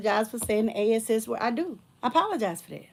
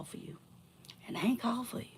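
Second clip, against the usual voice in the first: soft, breathy warning; whispered warning; 11 dB quieter, faster than usual. Real, not acted. Warning the ex off, whispered. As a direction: warn him under your breath.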